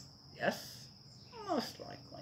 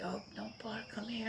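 whisper; 7 semitones higher, 10 dB quieter than usual. Pretend. Owner calling dog back, imagined.

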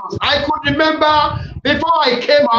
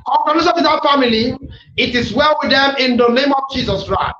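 ordinary talk, nothing marked

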